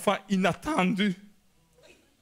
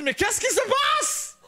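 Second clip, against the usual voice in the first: high, slow, laughing panic; high bewildered laughing cry; panicked cry; slower than usual. Retold or imitated. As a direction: wail in disbelief. Overwhelmed father.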